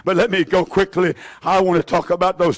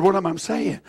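ordinary talk

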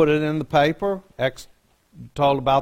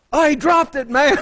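high, louder laid-back surfer report; high airhead surfer voice; 12 semitones higher, 5 dB louder than usual. Retold or imitated. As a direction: friend breaking the news in a high, louder airhead voice. Friend reports the crash.

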